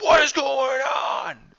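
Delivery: high, slow, loud shout of alarm; panicked shout. Retold or imitated. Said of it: "The rest of the chamber is freaking out".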